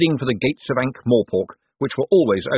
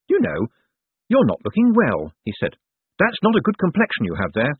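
higher-pitched needling remark; mock concern; 7 semitones higher than usual. A narrator's reading. Moist to sickly Cosmo about his complexion, mock concern. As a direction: comment on his looks, pitched up.

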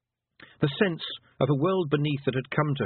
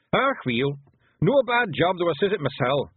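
higher Scottish pictsie voice; pleased little Scots warrior; Feegle leader; 6 semitones higher, 4 dB louder than usual. Acted. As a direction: play a satisfied wee Scottish fighter.

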